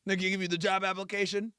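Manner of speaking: ordinary delivery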